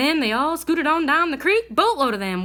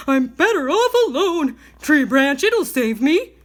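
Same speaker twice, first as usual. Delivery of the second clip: bear crying out; 4 dB louder than usual. A narrator's reading.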